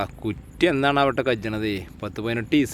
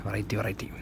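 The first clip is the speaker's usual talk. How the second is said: whispered; 8 dB quieter, much faster than usual. Real, not acted. Whispered aside while eating.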